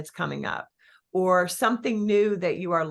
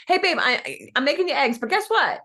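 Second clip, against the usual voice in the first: high, loud playful call to husband; teasing wife call; 7 semitones higher, 4 dB louder than usual. Retold or imitated. Instagram lady calling her husband, playful and high. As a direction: call out to your husband in the other room. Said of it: "she said".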